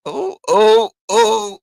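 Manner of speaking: high loud teasing ooh. Real, not acted. Loud mock exclaim after almost saying.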